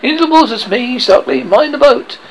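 high, loud captain's commands; shouted orders. A narrator's reading. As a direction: shout orders to the crew, pitched well up. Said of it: "Hook shouted".

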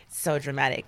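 ordinary talk

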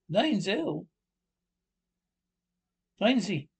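put-on firm German accent correcting, Nein falling; German agent insisting Nein, Zell with a falling tune; mock German accent. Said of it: "Two German agents".

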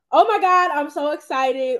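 loud, high burst, stretched out at the end; imagined excitement. Retold or imitated. Interviewer imagines guest's reaction to first earnings, mock excitement. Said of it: "Were you like".

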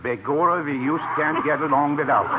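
stagey Irish boss voice, begorra; hammed-up Irish dialect on the phone; Irish accent. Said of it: "I changed my voice with Irish dialect".

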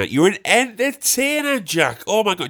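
high-pitched, over-impressed mimicry; impressed gardener. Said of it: "replaced all the mates with Jack".

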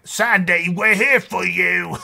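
high, slow, hammed-up put-on British accent; a bad British accent, drawn out and earnest; terrible accent impression. [name] comforting Sandy, in a hammed-up British accent. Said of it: "Apologize for my terrible accent impersonation".

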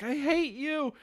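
high-pitched mock tantrum; imitating upset kid. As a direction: play a distraught boy lashing out, voice pushed high. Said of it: "starts crying, gets upset".

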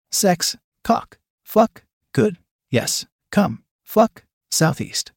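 single words, each set apart by a pause; disjointed inner monologue, word by word; fragmented thoughts. A narrator's reading. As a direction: break it into isolated, halting bursts. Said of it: "couldn't even make full words".

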